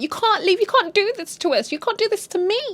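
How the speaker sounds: high pleading wife; high desperate plea. Retold or imitated. Wife pleading over suitcase.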